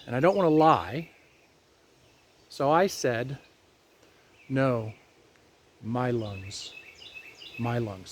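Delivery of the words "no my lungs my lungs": hushed, polite no; soft, kind refusal. Retold or imitated. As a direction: lower and much softer, gently. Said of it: "politely and kindly".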